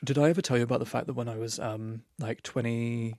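understated butch-guy act; subdued, blokey delivery; straight voice. Shown as a demonstration. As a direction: settle into a lightly put-on straight man's voice. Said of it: "coming down here to my straight voice".

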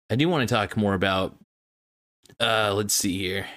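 talking through hiccups. Real, not acted. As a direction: hiccups breaking into the words. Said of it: "Sorry, these hiccups are not exactly going away".

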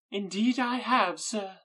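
a high, anxious, gushing matron; fussy Mrs Bennet voice. Acted.

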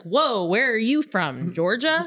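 slow, drawn-out mock astonishment; taken-aback customers. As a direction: imitate startled diners, rising at the end. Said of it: "they were just like".

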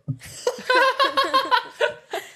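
shrieking laugh, high; high burst of laughter. Real, not acted. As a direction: squeal with laughter. Laughing at her own line.

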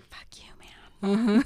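slow, hushed "Fuck you, man"; Leah's mock whispered insult; whispered curse. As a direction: spit it under your breath. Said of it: "And then Leah's like".

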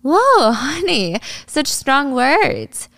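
high, stretched-out patronizing tone; mocking condescension. Yuri brushing off Ari's accusation, condescending. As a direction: draw it out with fake shock.

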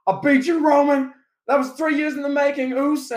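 high, loud wrestler call-out; Jey Uso. Pretend. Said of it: "like, Jey Uso".

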